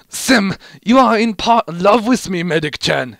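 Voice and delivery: high, loud mock-amazed voice; hammed-up shocked realization; Heavy voice. A narrator's reading. Heavy realizing Medic loves him, high loud mock amazement. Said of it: "Heavy said".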